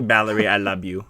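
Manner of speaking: lightly put-on Filipino accent, a bit low. Brother talking to his younger sister, light Filipino accent. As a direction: tease affectionately in a Filipino accent. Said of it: "so I'd be like".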